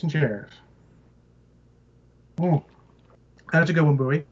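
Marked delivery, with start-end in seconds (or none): 2.4-4.2 talking between sips of a drink. Real, not acted. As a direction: speak just after sipping through a straw. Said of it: "Sorry, I'm drinking".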